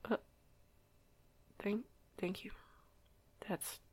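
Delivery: soft, stumbling, embarrassed thank-you; shy, halting thanks after a compliment; flustered quiet thanks. Acted. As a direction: caught off guard, barely above a whisper.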